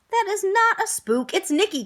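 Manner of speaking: excited cry. Acted. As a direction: blurt it out, sure you've got it. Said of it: "cried Albert".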